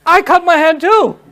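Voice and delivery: high, loud, whining me-too voice; acted whine for the servant to notice her; whiny plea. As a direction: beg for attention like a jealous guest. Women vying for his attention.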